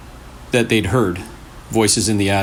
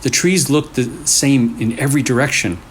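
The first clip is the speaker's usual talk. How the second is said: usual speaking voice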